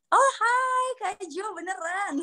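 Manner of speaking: very high, stretched-out greeting; delighted surprise. Real, not acted. Coach suddenly recognises her friend mid-answer, delighted surprise. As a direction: squeal hello on recognising a friend.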